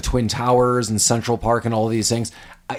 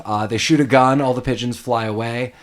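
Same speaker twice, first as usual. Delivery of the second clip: usual speaking voice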